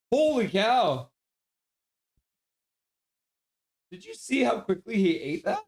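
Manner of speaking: high, loud holy cow at the dog; real astonishment at the dog's speed; amazed. Real, not acted.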